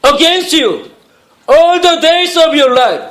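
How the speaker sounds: very slow, carefully paced English reading; slow English Bible reading to the congregation; scripture reading. Acted. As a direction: lay each phrase out slowly for the church. Reads verses in English.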